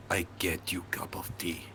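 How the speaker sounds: put-on menacing whispered Russian accent; sinister hushed Russian-accented threat. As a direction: hiss the offer like a Russian villain. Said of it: "a Russian accent in my place of work".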